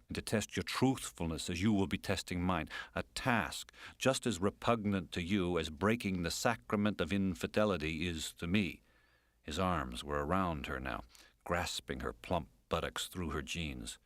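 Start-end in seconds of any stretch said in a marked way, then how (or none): none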